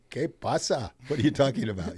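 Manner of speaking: imitating a puzzled worker with a Spanish accent; hammed-up Spanish accent, baffled. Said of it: "I, with a Spanish accent".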